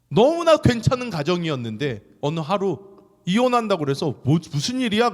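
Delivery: high, loud fervent sermon; impassioned preaching. Acted. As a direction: preach it with full fire. Preaching at full force.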